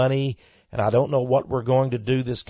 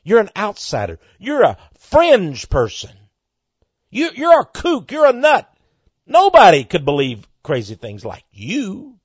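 building to a loud, high jeer; mock him louder and louder; devil's taunting; 7 semitones higher, 9 dB louder than usual. The devil tormenting a believer, loud rising jeers. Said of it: "the devil likes to torment you".

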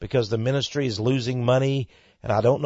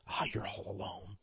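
taunting whisper; devil's whisper; 4 semitones lower, 17 dB quieter than usual. Retold or imitated. The devil tormenting a lonely believer, whispering. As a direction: hiss it softly like a tempter. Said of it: "the devil likes to torment you".

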